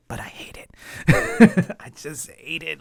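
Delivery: breathy giggling whisper. Real, not acted. Admitting to viewers she hates unread replies, whispering. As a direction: confess it in a hushed whisper through a laugh. Whispered confession after laugh.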